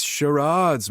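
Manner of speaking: "charades" said slowly as "shuh-RAAHDS"; drawn-out ah. A narrator's reading. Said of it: "drawn-out ah in the middle".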